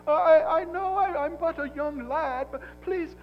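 high-pitched pleading British lad, hammed up; British accent. Elihu apologizing to his elders for daring to speak, pleading British accent. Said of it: "he has a British accent".

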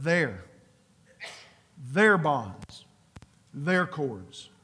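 "their" leaned on each time, falling; "their" marked as the owners of the bonds; stress on their. Shown as a demonstration. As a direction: press every "their" as the key word. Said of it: "Notice the word".

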